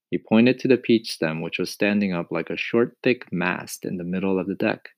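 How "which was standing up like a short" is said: ordinary delivery, nothing marked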